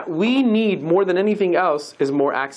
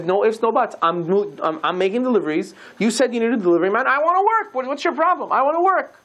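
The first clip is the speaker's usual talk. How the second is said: rising higher into an emphatic I wanna work; insistent rabbi; 4 semitones higher, faster than usual. Retold or imitated. Rosh Yeshiva insisting to the parents on working, higher and emphatic. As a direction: rabbi brushing off the parents' protests, pitching up.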